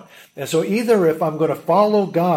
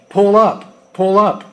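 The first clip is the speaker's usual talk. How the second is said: loud, raised automated cockpit alarm; computer warning voice; 5 semitones higher, 8 dB louder, slower than usual. Retold or imitated. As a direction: call it out like an urgent machine warning. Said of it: "a shrill computer synthesized voice".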